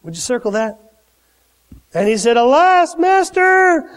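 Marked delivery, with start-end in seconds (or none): 2.3-3.9 high, acted wail of dismay; crying out. Servant to his master over a lost axe, crying out high. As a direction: call out to your master in alarm. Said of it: "he cried out".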